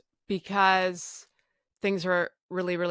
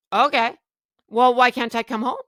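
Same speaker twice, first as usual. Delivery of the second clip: higher, much louder bargaining; desperate pleading; 6 semitones higher, 9 dB louder than usual. Retold or imitated. Patient arguing with the clinic worker on the phone, loud and pleading. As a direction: push back hard, begging.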